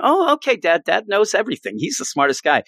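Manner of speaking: high, lightly voiced trusting kid; naive kid voice. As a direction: play the child who believes Dad knows all. Child voiced in story.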